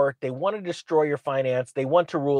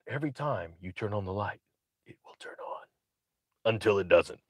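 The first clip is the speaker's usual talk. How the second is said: low hushed mock whisper; mock whispered quote; 9 semitones lower, 11 dB quieter than usual. Quoting the ninja in a mock hushed whisper. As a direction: whisper the quote like a dry joke. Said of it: "a book of quotes of the ninja".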